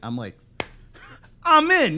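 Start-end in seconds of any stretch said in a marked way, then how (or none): 1.4-2.0 high, loud burst of enthusiasm; eager yes. Retold or imitated. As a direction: jump at the offer, much louder. Jumps at offer of expensive cigar.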